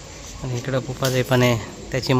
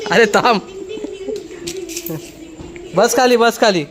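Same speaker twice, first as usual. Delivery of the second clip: higher, firm command to sit, half laughing; ordering the dog; 10 semitones higher than usual. Real, not acted. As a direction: tell the excited dog to sit down.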